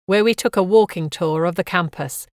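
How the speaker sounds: ordinary delivery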